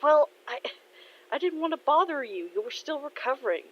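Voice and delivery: high, defensive stammer; flustered excuse. Acted. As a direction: make an awkward excuse to a friend. Flustered excuse to injured friend.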